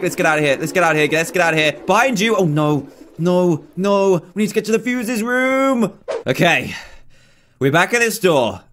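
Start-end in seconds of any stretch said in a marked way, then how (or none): none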